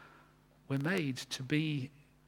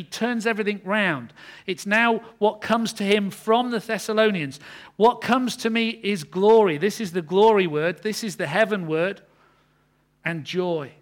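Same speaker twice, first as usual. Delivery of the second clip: louder, rising preaching on glory; fervent preaching; 5 semitones higher, 9 dB louder than usual. Acted. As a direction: lift each phrase toward heaven.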